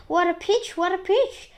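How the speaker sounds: high, loud exclamations; excited shouting. A narrator's reading. Aunts dancing round the growing peach, excited shouting. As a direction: cheer it out, high. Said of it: "shouting as those of silly things in the excitement".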